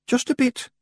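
ordinary delivery

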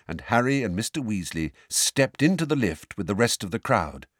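plain reading voice

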